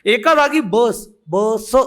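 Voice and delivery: usual speaking voice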